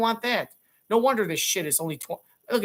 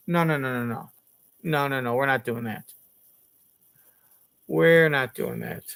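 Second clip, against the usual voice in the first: firm refusal; 7 semitones lower than usual. Pretend. Refusing mock gift.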